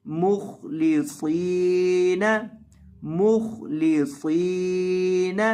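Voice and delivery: slow letter-by-letter buildup of मुखलिसीन; spelling out slowly. Shown as a demonstration. Teacher builds the word for students, slow syllable drill. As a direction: spell it out syllable by syllable, then join. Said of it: "spelling out letter by letter".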